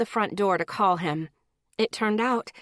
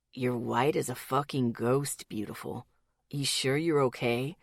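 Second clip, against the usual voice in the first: lower, concerned male voice; worried man's voice; 8 semitones lower, 5 dB quieter than usual. A narrator's reading. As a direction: ask it low and worried. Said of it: "his voice worried".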